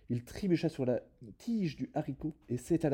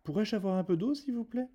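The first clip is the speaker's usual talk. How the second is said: much higher, sweet asking voice; polite request; 12 semitones higher, 3 dB louder than usual. A narrator's reading. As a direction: ask the giantess nicely for water.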